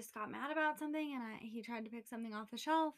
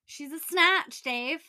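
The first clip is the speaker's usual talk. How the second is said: loud mock insult; 6 semitones higher, 14 dB louder, slower than usual. Real, not acted. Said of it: "We can call her what she really is".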